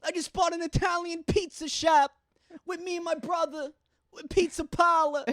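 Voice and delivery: high-pitched hammed-up New York working guy; high New York accent. Boasting of a new pizza shop, high New York accent.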